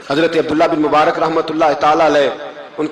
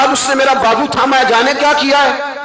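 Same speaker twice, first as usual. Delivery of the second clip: weeping husband; 10 semitones higher than usual. Acted. Goldsmith weeping in story.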